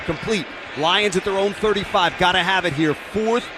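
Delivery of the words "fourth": tense play-by-play. Real, not acted. Announcer sets up the big down.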